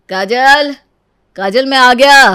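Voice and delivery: loud cheerful call. Acted. Husband calls his wife on coming home, loud. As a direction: call her name from the door.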